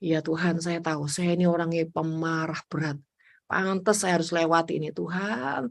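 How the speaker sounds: low, soft humble prayer; quiet confessing prayer. Retold or imitated. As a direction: confess quietly to God, head bowed. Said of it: "Saya akan bereaksi gini".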